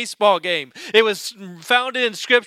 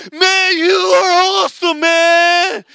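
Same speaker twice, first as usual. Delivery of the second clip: loud, high, slurred cheering shout; drunk fan yelling; 8 semitones higher, 6 dB louder, slower than usual. Retold or imitated. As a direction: bellow praise like a tipsy fan. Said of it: "probably half drunk".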